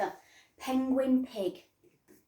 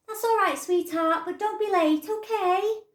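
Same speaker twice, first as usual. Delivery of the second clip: higher, louder sing-song parent voice; sweet mum; 10 semitones higher, 8 dB louder than usual. Acted. Mum letting her daughter go out, sing-song. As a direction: answer lightly, not really listening.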